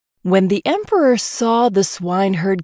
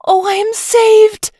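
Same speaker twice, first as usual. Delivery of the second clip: much higher and louder, slow gushing joy; delighted cry; 8 dB louder, slower than usual. Acted. Princess to the prince, delighted squeal. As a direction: squeal with relief. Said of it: "said the princess in delight".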